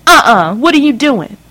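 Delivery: high, loud enraged mother's voice; a scandalised mother tearing into her daughter; furious mother. Acted. Mother stops daughter at closet.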